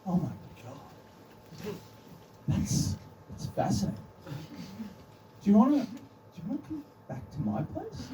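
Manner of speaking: soft, half-whispered gushing from the date, jumping high on the invitation; mock smitten date. Comic imitating his dazzled date, soft swooning invite. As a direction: play the date, swooning at the crisps fact. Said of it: "she's like".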